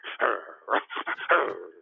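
wordless mock growling; imitated animal growl. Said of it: "he was growling at me".

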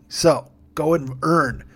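slow, drawn-out command. Pretend. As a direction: draw it out like a pimp giving orders. Slow build before the shout.

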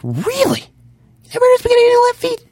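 high squeaky disbelief, mocking a music snob; incredulous friend. Friend shocked the host never heard the band, squeaky mock disbelief. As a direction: pitch it way up like you can't believe they don't know the band. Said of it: "And he's like".

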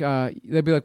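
ordinary delivery

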